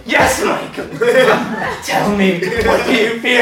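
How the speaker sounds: high-pitched spooky taunt; haunt character. Retold or imitated. Haunt actor taunts the frightened visitor, creepy teasing. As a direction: toy with a scared victim. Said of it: "I go up to him like".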